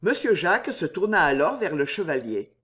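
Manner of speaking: plain reading voice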